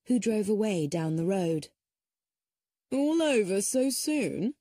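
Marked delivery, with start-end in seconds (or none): 2.9-4.5 slow, higher, drawn-out ironic echo; mock the boys' words with dry irony. A narrator's reading. Cedric echoing the boys to the family, slow ironic mockery.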